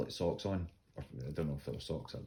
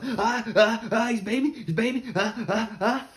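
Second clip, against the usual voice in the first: high, loud squealing panic; panicked yelp; 10 dB louder than usual. Retold or imitated. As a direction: yelp like a man being bitten by a dog. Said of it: "And he's like".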